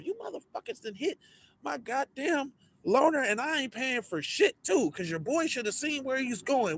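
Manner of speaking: a hinted going-off at the staff; mock rant. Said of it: "I'm outside going off".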